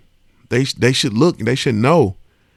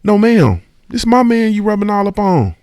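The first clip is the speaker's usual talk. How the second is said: wife's firm warning; 7 semitones higher, 4 dB louder than usual. Retold or imitated. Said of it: "And intervene".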